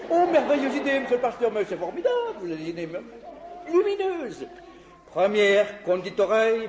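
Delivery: ordinary talk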